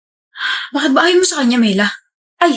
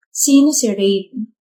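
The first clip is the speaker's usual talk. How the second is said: slow, pointed "Sino si Rain?" with weight on "sino"; pointed repeat; 3 semitones lower, much slower than usual. Acted. Girlfriend pressing her boyfriend about his ex, slow and pointed. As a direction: drag out "Sino si Rain?" to demand an answer.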